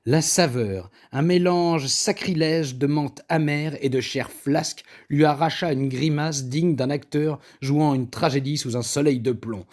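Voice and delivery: plain reading voice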